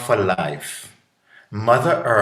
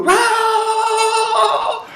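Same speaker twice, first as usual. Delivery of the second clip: sung high wow of delight; child's wow; 5 dB louder than usual. Retold or imitated. Child on a roller coaster, delighted singing wow. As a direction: let it soar like a kid on a ride. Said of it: "and they go".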